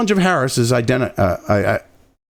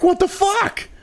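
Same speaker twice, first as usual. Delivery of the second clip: much higher, hammed-up disbelief; mock baffled outrage; 13 semitones higher, slower than usual. Mocking critics stunned that Trump can't be silenced, in a high voice. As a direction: squeak out their confusion. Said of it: "they're like".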